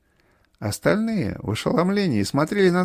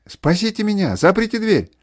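high, raised cry for help; mother's cry; 5 semitones higher, 5 dB louder than usual. A narrator's reading. Mother crying into the phone to be saved, frightened shout. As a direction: cry out desperately for rescue. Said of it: "раздался хриплый крик матери".

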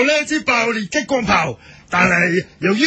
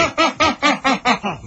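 mad scientist laugh. Pretend. Doctor shows his invention.

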